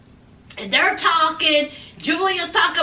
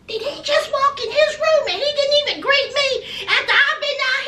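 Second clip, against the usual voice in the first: high, building indignant rant that ends in a fast shout; working herself up into outrage, ending in a yell; a retold wife's outraged rant; indignant outburst; 6 semitones higher than usual. Julia outraged at being ignored.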